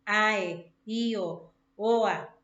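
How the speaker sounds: "Ae, io, oa" loud, each vowel pair set apart; diphthong demonstration. Shown as a demonstration. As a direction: sound out "Ae", "io", "oa" as joined vowel pairs. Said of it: "los diptongos son cuando se juntan dos vocales".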